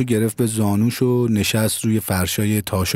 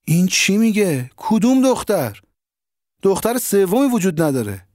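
frowning angry disbelief; 8 semitones higher than usual. A narrator's reading. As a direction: snap the question out, pitch way up. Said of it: "ابروهاشو گره کرد و رو به من پرسید".

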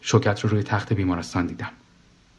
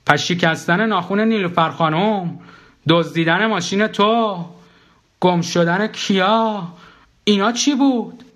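high, slow, pressing questions building higher; pleading bewilderment; 9 semitones higher, slower than usual. Acted. The dead man demands answers from Karim, higher, slow and building. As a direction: pile up the mysteries, climbing in pitch. Narrator voices himself, rising.

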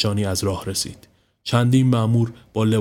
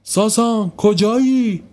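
much higher calling shout; shouting a name; 11 semitones higher, 4 dB louder, much slower than usual. Acted. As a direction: call down into the basement. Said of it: "بلند داد زد".

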